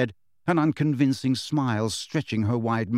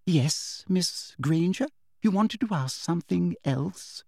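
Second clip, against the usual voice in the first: lightly voiced prim teacher, falsely kind; sweet teacher voice; 7 semitones higher than usual. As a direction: talk down to her with syrupy politeness.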